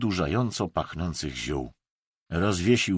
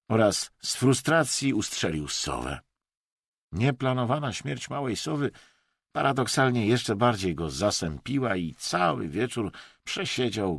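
ordinary delivery

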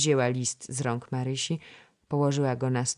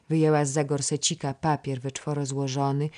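ordinary delivery, nothing marked

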